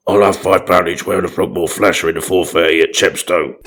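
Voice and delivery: put-on character voice placing a bet; steady betting-shop patter in character; put-on racing punter. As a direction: read the bet out evenly in a put-on voice.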